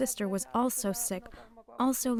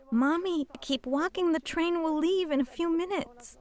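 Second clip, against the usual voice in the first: higher-pitched, urging plea; urgent pleading; 7 semitones higher than usual. Retold or imitated. Child to collapsing mother before the train leaves, urgent pleading. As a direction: plead with them to keep moving.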